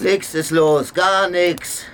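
loud, high denial; defensive shout. Acted. Man loudly denies anything is wrong to the girl. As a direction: shout her down to hide the truth.

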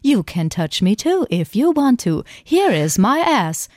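slow, stiff robot voice. Pretend. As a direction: speak like a humanoid robot. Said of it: "Ich könnte das die ganze Zeit machen".

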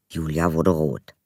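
ordinary delivery

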